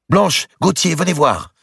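loud high call across the room. Acted. Queen calling her cousin and lover to the window, loud. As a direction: summon them to the window.

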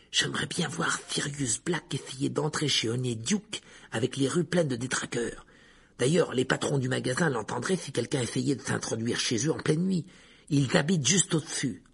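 plain reading voice

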